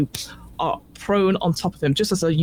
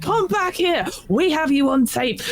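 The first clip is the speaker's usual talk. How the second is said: mock shouting; 8 semitones higher than usual. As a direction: imitate someone calling after a runner. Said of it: "Heather shouting".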